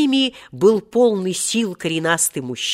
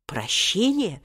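put-on surprised old man's wheeze; 9 semitones higher than usual. A narrator's reading. Old Seryozha asks Omar Yusuf whose pardon, astonished and hoarse. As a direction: wheeze it out in rising disbelief. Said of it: "удивлённо прохрипел старец Серёжа".

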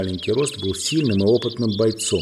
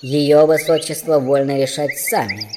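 slow, louder character voice; firm dwarf; 5 semitones higher, 5 dB louder than usual. A narrator's reading. Dwarf answers the protesting prince, firm. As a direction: answer firmly as a formal dwarf.

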